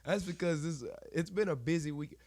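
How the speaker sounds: hoarse, stuffed-up voice; talking through a congested nose. Real, not acted. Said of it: "why I sound like Fraggle Rock right now".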